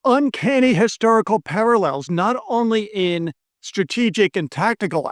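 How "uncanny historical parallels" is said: ordinary delivery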